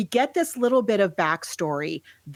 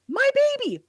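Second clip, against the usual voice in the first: high mock-frantic cry; hammed-up panicked mom, pitched high; frantic mother; faster than usual. Mother spotting her runaway pram, mock panic high. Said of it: "she turns around and she goes".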